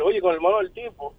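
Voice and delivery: usual speaking voice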